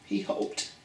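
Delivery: unvoiced hushed whisper. Teacher whispering the story's quiet moment to children. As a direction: breathe it out like a secret.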